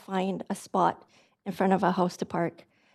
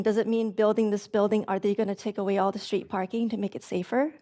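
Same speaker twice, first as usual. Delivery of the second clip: usual speaking voice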